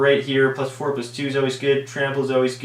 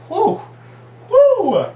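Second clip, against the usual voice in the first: mock whew; 8 dB louder than usual. Overexcited about a cheap removal card.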